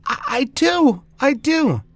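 put-on high, loud stammered child's reply; eager little boy. A narrator's reading. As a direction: insist keenly as a small child.

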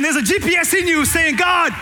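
shouted high and loud; preaching shout. Real, not acted. Preacher shouting to the congregation about the inner GPS. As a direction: cry it out to the whole church.

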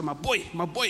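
put-on light Natal South African accent on my boy; affectionate South African bloke calling out my boy, lightly done. Preacher imitating South African guys, light South African accent. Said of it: "Travis speaks a little bit like that".

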